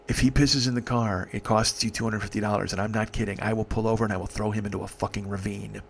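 low, softened retold warning; quiet threat. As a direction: drop the voice into a menacing aside. Leans over to threaten her.

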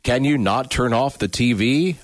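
ordinary talk

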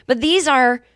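ordinary talk, nothing marked

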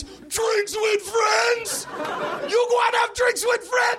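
put-on very high, incredulous Jamaican-accented shout; outraged Jamaican cabbie. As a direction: yell in Jamaican disbelief, ending in a mock laugh. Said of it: "my Jamaican accent".

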